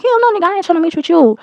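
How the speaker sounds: high-pitched, indignant comeback; retold scandalized no; emphatic retold refusal. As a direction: refuse it outright, acting out the argument. Retelling her emphatic refusal.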